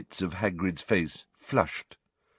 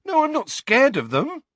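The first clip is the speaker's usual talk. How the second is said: high, louder, hurt protest; upset denial; 5 dB louder than usual. A narrator's reading. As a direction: insist against the accusation. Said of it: "looking upset".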